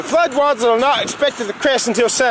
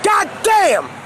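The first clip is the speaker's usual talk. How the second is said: frustrated curse; 7 semitones higher than usual. Real, not acted. Struggling with the tablet.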